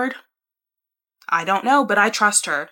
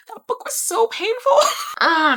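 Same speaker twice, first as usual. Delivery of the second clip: high laugh through the words; 4 dB louder than usual. Real, not acted. Laughing to viewers about a painful ending, high. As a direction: laugh it off while still hurting.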